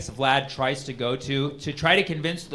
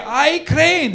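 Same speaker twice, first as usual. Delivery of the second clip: a hint of a higher bird voice; lightly voiced bird; 12 semitones higher, 8 dB louder than usual. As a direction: say it like a small bird introducing itself. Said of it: "Bird say".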